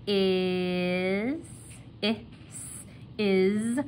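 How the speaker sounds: "is" stretched and sounded out; voiced S. Shown as a demonstration. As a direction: draw out "is" to its buzzing end. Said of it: "I've got my voiced S there".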